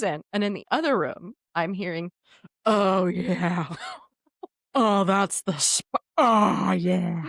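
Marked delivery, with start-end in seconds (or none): 2.6-7.3 long, slow mock groans of pleasure; imitated moaning guy. Guy heard from the other room, mock moaning. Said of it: "That's a guy".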